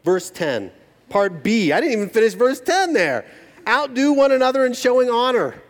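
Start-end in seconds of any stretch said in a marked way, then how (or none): 3.7-5.6 scripture reading. Acted. Scripture read aloud.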